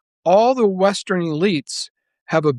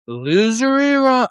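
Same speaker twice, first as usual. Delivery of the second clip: much higher, slow, louder taunt; mocking Trump; 10 semitones higher, 3 dB louder, slower than usual. Mocking a president's threat against Iran in a slow high taunt. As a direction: ham up a leader's schoolyard insult. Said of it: "although he threatens".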